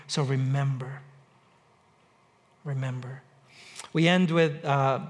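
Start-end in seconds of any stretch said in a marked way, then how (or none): 0.1-1.0 a quiet, lowered plea; soft, lower remember. Real, not acted. Pastor urging the congregation to remember, softly. Closing plea, softening to the end. 2.6-3.2 much softer, lower, almost breathed; near-silent remember. Real, not acted. Pastor's last hushed word to the congregation, barely audible.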